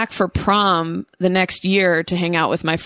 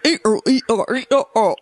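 mock British syllables; 4 semitones higher, faster than usual. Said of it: "It's like".

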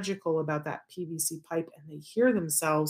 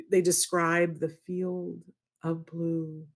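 sleep story narrator; slower than usual. Retold or imitated. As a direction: drift them off to sleep. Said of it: "the person who's like".

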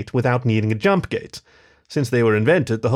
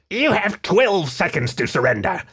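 higher-pitched squeaky rat-man voice; rat villain; 6 semitones higher than usual. A narrator's reading. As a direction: issue an ultimatum as a ratty radio foe. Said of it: "said the rat jerk".

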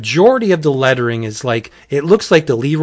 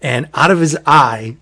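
normal talking voice

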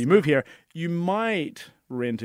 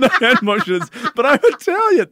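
laughing protest; 11 semitones higher, 11 dB louder than usual. Real, not acted. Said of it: "joking protest, laughing".

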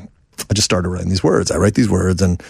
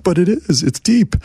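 defensive; 10 semitones higher than usual. Retold or imitated. Defending his lyric to the pop guys.

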